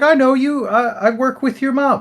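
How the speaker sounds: very high mimicking voice; put-on high lady voice; imitated campaigner lady.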